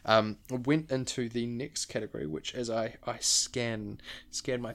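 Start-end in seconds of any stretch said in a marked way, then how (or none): none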